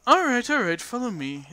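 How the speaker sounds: lightly voiced old landlady, lilting up and down; old woman grudgingly giving in; a hint of crotchety old innkeeper. Innkeeper greets the guards.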